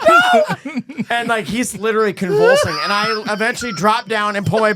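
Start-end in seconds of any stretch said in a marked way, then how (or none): 0.0-0.5 very high, loud squeal; crying-laughing refusal. Retold or imitated. Imitating the driver crying laughing, high squeal. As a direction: squeal it helplessly, imitating a friend in hysterics. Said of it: "he's crying, laughing, crying".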